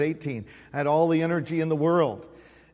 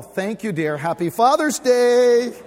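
sarcastic thanks; 6 semitones higher, 6 dB louder than usual. Retold or imitated. Mock thanks to laughing, unsympathetic wife.

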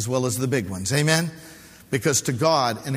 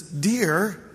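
wife's warning dear; 6 semitones higher than usual. Retold or imitated. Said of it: "whenever she says "dear," watch out".

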